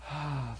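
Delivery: soft, low, sinking "ah"; disheartened sigh. Acted. Preacher voicing what our heart does first, disheartened sigh. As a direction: let the heart sink in one quiet sigh. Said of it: "Usually our heart goes".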